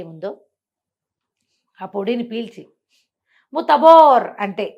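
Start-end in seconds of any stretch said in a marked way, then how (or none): 3.5-4.3 loud, high incantation; magic word. Shown as a demonstration. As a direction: call out the spell boldly. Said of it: "ఇలా అంటే".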